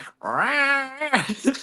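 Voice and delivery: high, drawn-out cat screech; long mock feline yowl; imitated cat yowl. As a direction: imitate an angry cat yelling. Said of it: "yelling at me".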